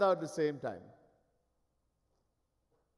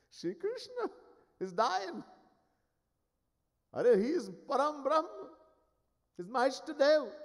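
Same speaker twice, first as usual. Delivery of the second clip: shocked disbelief; 10 semitones higher than usual. Acted. Narad shocked at Krishna's illness.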